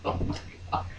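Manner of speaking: breathy whispered oh my God. Real, not acted. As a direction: whisper it under your breath. Whispered aside.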